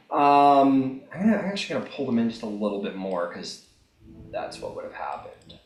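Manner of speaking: normal talking voice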